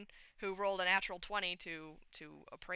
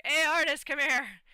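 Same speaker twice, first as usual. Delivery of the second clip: loud call across the room, pitched up; 8 semitones higher, 10 dB louder than usual. Retold or imitated. Player calling Artis over, loud and high. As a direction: shout for Artis to come over.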